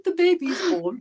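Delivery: a lightly voiced high, sweet angelic announcement with a laugh; mock angelic birth. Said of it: "not the nice angelic".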